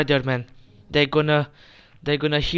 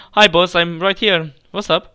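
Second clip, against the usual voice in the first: cheery greeting; 5 semitones higher, 11 dB louder than usual. Pretend. Imagines greeting the boss.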